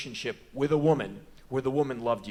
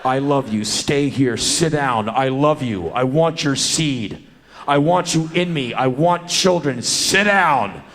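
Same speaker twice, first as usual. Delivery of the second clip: loud, intense, demanding declarations of love; grim loving woman shouting; 2 semitones higher, 10 dB louder, slower than usual. Acted. Woman ordering the man she loves to stay, loud and grim. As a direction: bark orders to a man she won't let leave.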